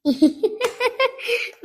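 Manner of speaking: put-on high, breathy drunken cackle; drunken giggle. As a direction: laugh at him tipsily. Drunk wife laughs at husband.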